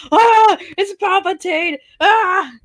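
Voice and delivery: high, slow mock scream; Luke panicking. Luke spots Palpatine, high hammed-up alarm. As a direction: shriek at the sight of the Emperor. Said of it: "Luke's like".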